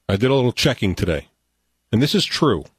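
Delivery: ordinary talk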